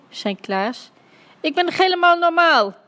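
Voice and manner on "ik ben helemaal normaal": high, loud indignant protest; offended huff. A narrator's reading. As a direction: protest loudly with wounded pride. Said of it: "snoof Dummy beledigd". (luid en hoog, beledigd)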